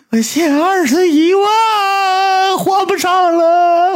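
hammed-up high, drawn-out bawling; slow, exaggerated crying wail; mock wailing. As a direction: imitate a debtor sobbing out a confession at a piercing pitch. Acts out the debtor's wailing confession.

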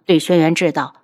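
usual speaking voice

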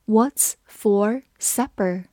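slow, careful reading. Shown as a demonstration. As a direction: read "What's for supper" very slowly. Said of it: "把第一个句子慢读一遍".

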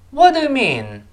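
"What do you mean" starting high and falling steeply; falling question tune. Shown as a demonstration. Teacher models a wh-question for students, "What do you mean" with falling tune. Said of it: "一定要降调".

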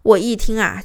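ordinary talk

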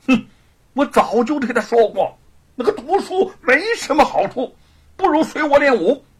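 loud, high character voice, reproachful; forceful, lamenting complaint; regretful grumbling. Acted. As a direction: grumble that nobody took your advice. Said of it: "不禁叹息着说".

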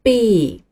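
"B" said as English bee, clear; 英文字母B的读音; English letter B. Shown as a demonstration. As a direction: model "B" as bee, not pinyin bo. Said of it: "这个字母读作".

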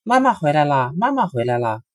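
Red Eyes calls happily that mother is back; happy shout. A narrator's reading. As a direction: call out gladly. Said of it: "高兴地叫着".

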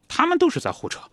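father cutting in. Retold or imitated. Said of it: "奥托立刻打断了儿子".

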